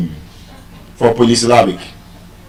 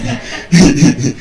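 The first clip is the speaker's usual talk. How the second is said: laughing. Real, not acted. Joke about long university years.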